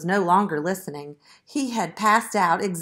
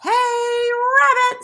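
high, loud call from outside; yelling; 8 dB louder, slower than usual. A narrator's reading. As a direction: holler out for the rabbit. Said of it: "someone yelled outside".

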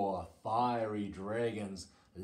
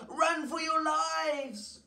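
high, loud, drawn-out panic cry; high-pitched slow shout of alarm; storybook scream; 5 dB louder than usual. A narrator's reading. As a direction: scream a warning, stretched out and high. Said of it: "they screamed".

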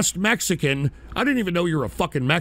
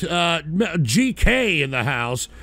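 usual speaking voice

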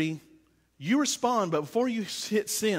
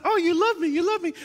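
higher, louder happy dog voice; dog's happy voice; 8 semitones higher, 5 dB louder than usual. Pretend. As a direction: voice the dog, delighted and eager. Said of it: "She's like".

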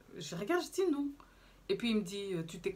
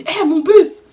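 slow, higher, loud cry of hé, mon bus; shouting after the bus; 8 semitones higher, 20 dB louder, much slower than usual. Retold or imitated. Retelling her shout at the border police, loud and high. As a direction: yell after the leaving bus in a half-replayed panic. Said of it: "j'étais vraiment en train de crier".

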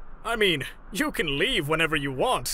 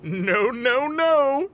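shouting; 9 semitones higher, 6 dB louder, much slower than usual. Acted. All Might refusing, yelling back.

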